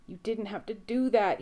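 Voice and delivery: loud anguished cry. A narrator's reading. Sam crying out over Dean's body. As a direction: cry it out over his dead brother. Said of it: "he cries out".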